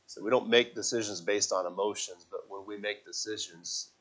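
ordinary talk, nothing marked

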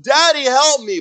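put-on high, loud child's cry for daddy; child calling for help. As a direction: play a stuck little boy shouting for help. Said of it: "he starts calling out for help".

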